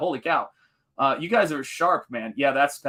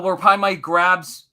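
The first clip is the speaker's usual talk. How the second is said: ordinary talk, nothing marked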